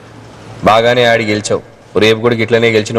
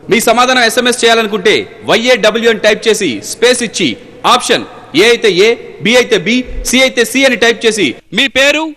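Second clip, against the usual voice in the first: higher, bright TV announcer reading contact details; 11 semitones higher than usual. Acted. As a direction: read the address like a quiz host promo. Host reads SMS and address instructions. (TV anchor ప్రకటన శైలి)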